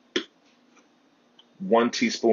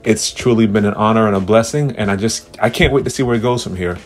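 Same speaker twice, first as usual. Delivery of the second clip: big booming show host voice; announcer; 6 dB louder than usual. Pretend. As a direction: play the grand host welcoming fans. Grand mock podcast host intro.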